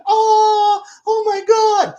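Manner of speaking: high, loud, hammed-up open-mouthed "oh my God"; mock the gaping thumbnail face, crying out; mock gasp of amazement. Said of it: "they hold their mouth open".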